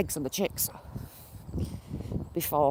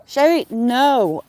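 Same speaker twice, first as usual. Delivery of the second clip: loud, slow warning to the dog; calling the dog off, loud and slow; firm call; 5 semitones higher, 7 dB louder, slower than usual. Real, not acted. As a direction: stop her with a drawn-out no. Scolding the dog.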